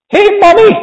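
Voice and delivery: very high-pitched demanding call; sudden child's shout. A narrator's reading. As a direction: yell for Mommy like a spoiled girl. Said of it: "shouted Veruca Salt suddenly".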